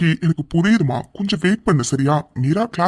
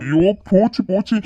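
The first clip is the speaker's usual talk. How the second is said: higher-pitched dismayed wail; frightened fretting; 4 semitones higher than usual. Acted. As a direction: groan as if all is lost. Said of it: "எதுக்குடா நீ பயந்துட்டு இருக்கா".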